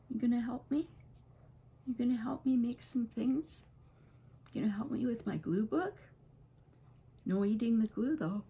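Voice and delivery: high sing-song questions to a dog; pet talk. Real, not acted. Owner asking her dog to help with the glue book, high playful pet talk.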